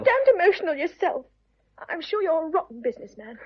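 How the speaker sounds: loud, high retort; emotional outburst. Acted. Woman bickering with her suitor, loudly worked up. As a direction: fling it straight back at a lover.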